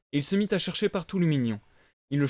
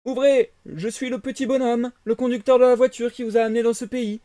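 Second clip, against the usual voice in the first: high, loud threatening coachman; gruff coachman; 9 semitones higher, 7 dB louder than usual. Acted. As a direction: bang on the door and demand. Coachman shouts at the door. (voix de cocher menaçant)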